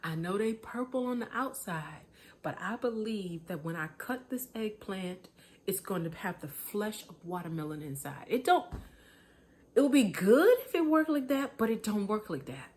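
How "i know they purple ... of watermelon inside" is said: softly voiced believer talking to herself; hushed mock faith. Preacher playing a hopeful gardener, hushed and earnest. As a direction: quietly insist the eggplant holds watermelon. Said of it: "go outside and be like".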